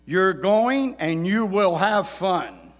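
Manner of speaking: loud, high no-arguments order; stern dad voice. Retold or imitated. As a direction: lay down the law like a father. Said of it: "words that many dads have said".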